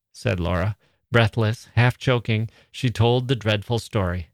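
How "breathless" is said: ordinary delivery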